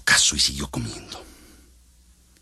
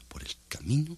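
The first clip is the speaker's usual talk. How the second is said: whispered; 10 semitones higher, 5 dB quieter than usual. A narrator's reading. Narrator whispering the road to listeners. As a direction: whisper the phrase softly.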